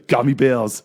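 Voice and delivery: put-on slow, laughing Austrian action-hero accent; a hammed-up Austrian accent, slow and through laughter; Arnold Schwarzenegger accent. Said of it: "in an Arnold Schwarzenegger accent".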